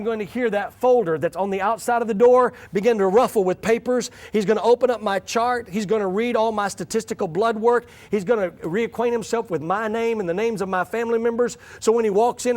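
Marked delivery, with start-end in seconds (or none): none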